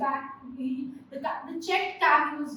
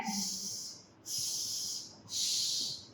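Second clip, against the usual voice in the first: three soft voiceless hisses; monkey shushing noise; 8 semitones lower, 12 dB quieter, much slower than usual. A narrator's reading. Monkeys answering the peddler, mocking hissed shushes. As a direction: imitate monkeys with short separate shushes. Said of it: "This is the noise that the monkeys are making".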